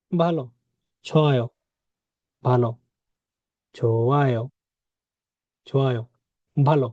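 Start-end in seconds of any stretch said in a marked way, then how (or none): 3.7-4.4 slow model. Shown as a demonstration. Teacher models word slowly, then normally.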